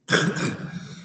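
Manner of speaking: sudden chesty cough. Real, not acted. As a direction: cough mid-sentence. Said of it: "Scusate la tosse".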